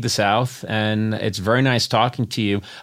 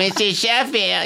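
high, slow sitcom-star impression; Fran Drescher; 7 semitones higher than usual. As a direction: imitate her cackle, then stretch the catchphrase. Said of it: "And you'd be like".

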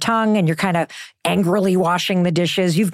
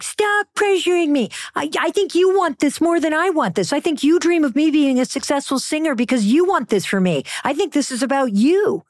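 mock exploding daughter; 7 semitones higher than usual. Daughter snapping back at her pushy mom, indignant. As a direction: imitate the daughter's outburst. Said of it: "She's like".

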